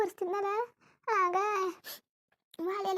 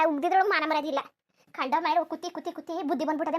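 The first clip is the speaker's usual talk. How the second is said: normal talking voice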